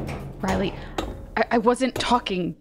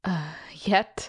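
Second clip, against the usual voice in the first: breathed, barely voiced question; uneasy whisper. Friend whispering back to the troubled speaker, uneasy. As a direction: whisper it, wary of the answer.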